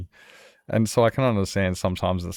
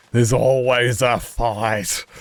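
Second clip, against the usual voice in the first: slow, louder repeat of a quoted friend; drawn-out repeat; 3 semitones higher, 5 dB louder, much slower than usual. Retold or imitated. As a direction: stretch it out to drive it home.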